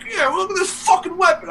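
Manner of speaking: very high-pitched cartoon comeback; retold retort. Retelling Bowser's comeback with his wife, high mock retort. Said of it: "Throws out his wife".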